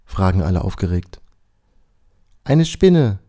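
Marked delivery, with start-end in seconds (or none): 2.4-3.2 high, loud cry of alarm; little sister yelling a warning; calling out. A narrator's reading. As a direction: shout it across the garden. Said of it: "ruft Timons Schwester zurück".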